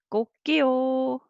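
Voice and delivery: high crow with a held last syllable; copying a rooster. Shown as a demonstration. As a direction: crow 꼭지요, stretching out the 요. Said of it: "copying the sound of rooster".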